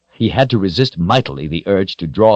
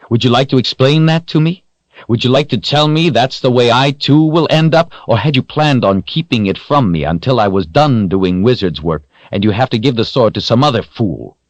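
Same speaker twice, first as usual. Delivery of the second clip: louder, higher, accusing hero; angry outburst; 7 semitones higher, 4 dB louder than usual. A narrator's reading. Richard accuses Zed of hiding Samuel's fate, louder and angry. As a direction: Richard confronts the wizard, voice rising in anger. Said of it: "Richard became more and more upset".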